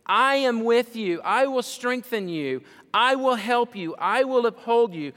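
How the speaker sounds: high, loud, each I will hammered; proclaimed promises. Shown as a demonstration. Preacher thundering God's promises to his church. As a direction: ring out I will help you like a vow. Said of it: "And he says".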